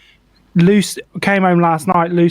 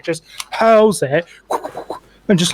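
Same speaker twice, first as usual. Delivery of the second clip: normal talking voice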